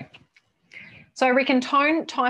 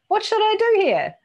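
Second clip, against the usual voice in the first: high, loud pleading voice of someone asking; whiny asker; 7 dB louder than usual. Pretend. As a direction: whine the question up high like a needy colleague.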